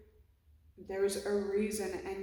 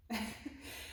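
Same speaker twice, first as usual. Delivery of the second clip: breathy laugh; 11 dB quieter than usual. Real, not acted. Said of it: "I'm an emotional mess right now".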